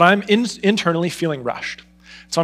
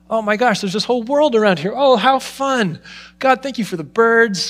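higher, delighted gasping thanks; delighted wonder; 7 semitones higher than usual. Retold or imitated. Pastor reliving his delight at the birds, higher and marvelling. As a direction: marvel at the world like a child.

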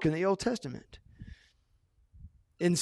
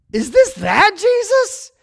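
amazed high question; 9 dB louder, slower than usual. Acted. Passerby amazed.

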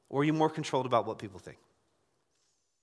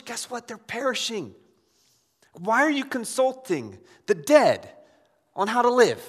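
loud, driving sermon voice, higher; preaching at full force; 4 semitones higher, 6 dB louder than usual. Acted. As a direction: press every point home to the congregation.

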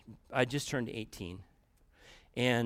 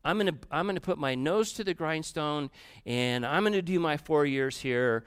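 defiant vow; 3 semitones higher, 6 dB louder than usual. Retold or imitated. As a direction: vow it like slamming the phone down. Defiant vow after slamming phone.

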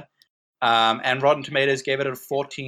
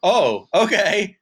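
surprised realization; 6 semitones higher, 6 dB louder, slower than usual. Retold or imitated. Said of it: "It was like".

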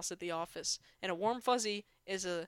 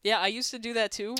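usual speaking voice

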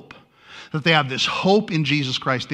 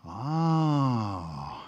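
long drawn-out, softer "oh"; a slow, put-on ooh of getting it; mock realization; 3 semitones lower, 7 dB quieter than usual. Preacher showing the X to the congregation, mock amazed. Acting out audience's dawning understanding.

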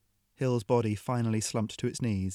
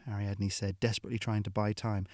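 plain reading voice

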